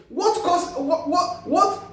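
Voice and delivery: normal talking voice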